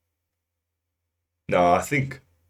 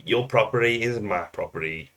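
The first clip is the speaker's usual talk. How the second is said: mock South African thief voice, put-on accent; a quick jokey South African accent; weird little South African accent; 2 semitones higher than usual. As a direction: say it like a cheeky South African crook. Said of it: "in that weird little accent".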